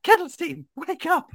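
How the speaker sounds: very high-pitched character call; character shouting back. Acted. Adventurer trying to rouse Kettle Steam, high character shout. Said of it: "I'd, like, shout back".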